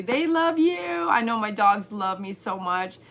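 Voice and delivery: ordinary delivery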